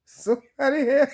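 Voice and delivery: high, loud half-laughing call for help; retold yell for help, laughing; mock yell. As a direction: call out for the nurse in fake panic. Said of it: "I'm yelling".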